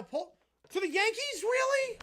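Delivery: stunned at the news; can't believe the trade; surprised disbelief. Real, not acted. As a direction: pitch jumps up on really, loud.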